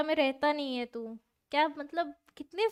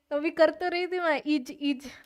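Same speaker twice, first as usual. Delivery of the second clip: put-on Bihari accent; 2 semitones higher, 6 dB louder than usual. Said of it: "Bihari accent".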